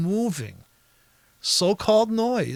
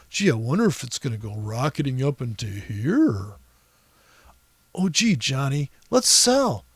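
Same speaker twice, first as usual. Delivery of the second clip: mocking naive trader; 4 semitones lower than usual.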